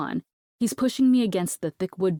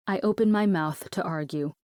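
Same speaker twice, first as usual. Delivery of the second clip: ordinary delivery, nothing marked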